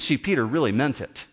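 usual speaking voice

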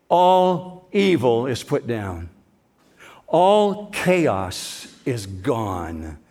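drawn-out, very slow proclamation; slow emphatic. Real, not acted. Pastor proclaiming evil's end to the church, very slow. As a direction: let each word land slowly.

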